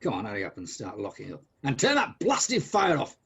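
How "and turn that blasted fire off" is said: high, slow, emphatic command; cross order. Acted. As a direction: punch out an irritated order. Scrooge orders the clerk crossly.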